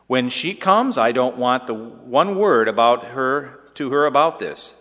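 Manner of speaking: high, loud, sputtering orders. Acted. Son ordering his mother about his bride, sputtering loudly. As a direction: lay down the law as head of the house. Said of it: "I sputtered".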